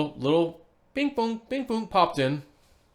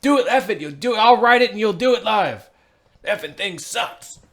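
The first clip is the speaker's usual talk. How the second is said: yelled, higher and louder mock rant; hammed-up rant; 8 semitones higher, 8 dB louder than usual. Egging J C on with a mock live-TV outburst. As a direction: goad him into doing it live. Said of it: "Say it, J C".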